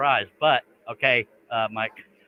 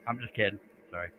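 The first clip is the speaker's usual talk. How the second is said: normal talking voice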